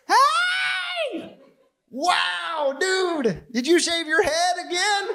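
high-pitched, hammed-up gushing greeting; mock gushing. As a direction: parody women's excited compliments on a male friend. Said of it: "if Mike walks in the room".

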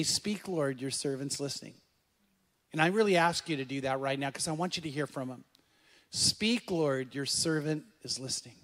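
soft, low, calming voice; hushed prayerful guidance with long pauses; guided prayer. Real, not acted. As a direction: quietly lead them to breathe and pray. Leads breathing and prayer.

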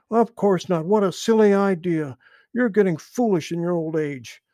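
high, loud scornful retort. A narrator's reading. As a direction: scoff at the old frog. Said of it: "retorted Little Joe".

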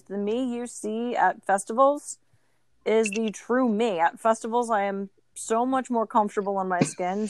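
usual speaking voice

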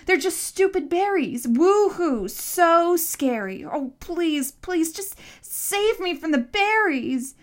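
high, loud mock pleading; sarcastic shouting. A narrator's reading. Boy mocking the monster's berries, loud sarcasm. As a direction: exaggerate the fake fear, stretching key words. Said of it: "you've never heard of sarcasm".

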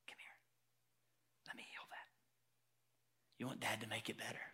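very soft, intimate whisper; tender fatherly whisper. Acted. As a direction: whisper gently like a father comforting his child. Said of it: "when we even hear Jesus like that".

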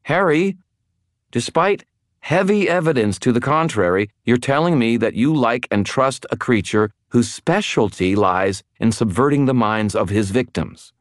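high-pitched, sharp lecture; exasperated, cutting reproach. A narrator's reading. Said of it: "her voice sharpening".

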